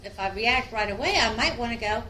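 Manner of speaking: ordinary talk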